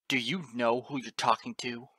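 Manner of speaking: loud, intimidating demand; angry challenge. A narrator's reading. Father pulling rank on his son, loud angry challenge. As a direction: challenge the boy loudly.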